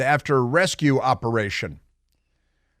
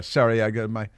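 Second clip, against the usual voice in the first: normal talking voice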